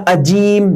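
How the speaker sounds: عجیم said "ajeem", with a j in place of ظ; wrong j sound. Shown as a demonstration. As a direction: show the mistake with a clear j in عجیم. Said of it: "جیم پڑھتے ہیں". (عجیم، جیم والا غلط تلفظ)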